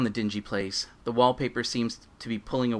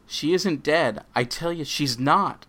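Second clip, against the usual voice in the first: desperate denial; 6 semitones higher, 5 dB louder, slower than usual. Acted. Dan denying in tears.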